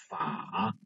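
法 said alone as a full third tone, dipping low then rising. Shown as a demonstration. Teacher showing students the tone of 法 said alone, a full dipping third tone. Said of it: "this one is the third tone syllable".

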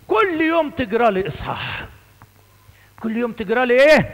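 lightly voiced old priest insisting; Sa'idi priest voice. Priest telling children to read a chapter daily, Upper Egyptian accent. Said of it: "كان يتكلم عربي بالعافية".

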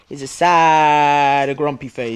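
low, drawn-out sad voice; mock sad. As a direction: stretch out SAD in a low, mopey voice. Describes sad face to child.

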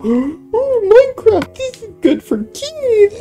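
very high squeaky mock voice; mocking YouTube reviewer. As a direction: parody a clueless reviewer, shrill and silly. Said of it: "they're gonna go".

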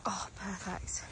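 hushed whisper to herself; whispered thought. Retold or imitated. Glad the hill is empty, whispering her thought. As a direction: whisper it like a private delight. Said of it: "I'm like".

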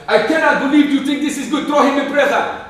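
put-on high, outraged African accent; infuriated African king. Furious king condemning his optimistic friend, outraged. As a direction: rage at him in a high-pitched royal outburst. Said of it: "king is frustrated and infuriated by it".

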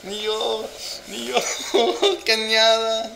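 high, giggling Canada; laughing at her own attempt. Real, not acted. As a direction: keep laughing through it. Cracking up at Canada.

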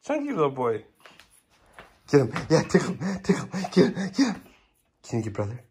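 ordinary talk

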